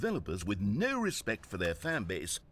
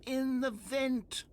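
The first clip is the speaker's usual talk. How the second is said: higher, slow echoing call from vent; 9 semitones higher, slower than usual. Acted. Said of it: "Okay, vent voice".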